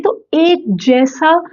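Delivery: usual speaking voice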